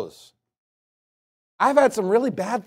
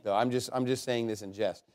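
usual speaking voice

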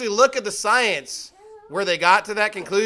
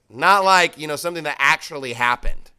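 normal talking voice

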